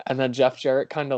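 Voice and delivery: usual speaking voice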